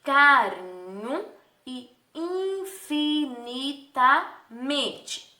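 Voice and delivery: "carinho" drawn out, "infinitamente" in separate syllables; teacher stretching each syllable of "infinitamente"; part by part. Shown as a demonstration. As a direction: slowly sound out "carinho" and "infinitamente". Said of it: "A mesma coisa vocês irão fazer".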